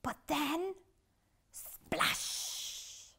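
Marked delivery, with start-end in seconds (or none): 1.5-3.1 breathy splash; splash sound. A narrator's reading. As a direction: spit out the splash. Crashes into sea.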